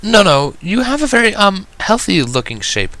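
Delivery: high, flustered refined lady; flustered explaining. A narrator's reading. Rarity flustered, reassuring the thin guest, high-pitched. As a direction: scramble to reassure without offending. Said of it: "she tried explaining".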